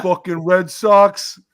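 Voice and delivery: loud, slow sneer. Retold or imitated. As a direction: growl it out slowly. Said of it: "and they go".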